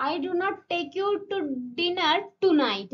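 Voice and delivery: slow read that ends leaning hard on tonight; stress on tonight. Shown as a demonstration. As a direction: land the whole weight on tonight. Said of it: "মানে আজকে রাতে নিয়ে যাবো না".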